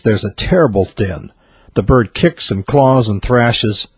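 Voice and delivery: usual speaking voice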